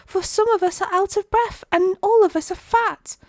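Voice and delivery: high, loud-ish protesting oyster voice; oysters crying out. A narrator's reading. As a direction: plead for a pause in a high little voice. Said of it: "the oysters cried".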